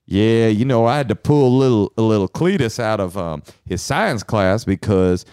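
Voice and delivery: put-on Southern right-winger; Southern accent. Co-host playing right-wing relative in role-play, Southern accent. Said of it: "I'm doing this Southern accent".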